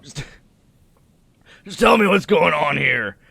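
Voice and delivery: loud, insistent demand; agitated husband. Acted. Husband demanding to know what's going on, agitated. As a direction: raise the voice and demand answers.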